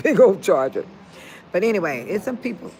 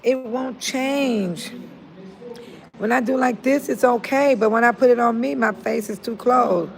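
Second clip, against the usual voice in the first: usual speaking voice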